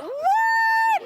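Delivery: high, loud, drawn-out "what?"; baffled youngsters. Acted. Host mimics youngsters baffled by Oxo in hot water, high mock shriek. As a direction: shriek it like an incredulous kid. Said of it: "the youngsters are like".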